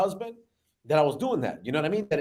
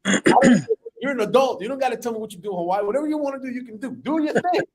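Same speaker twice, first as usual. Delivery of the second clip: higher-pitched, flustered objection; exasperated protest; 5 semitones higher, 3 dB louder than usual. Retold or imitated. Son telling mom she needn't share her plans, exasperated. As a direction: wave mom off in acted exasperation. Acts out son's embarrassed protest.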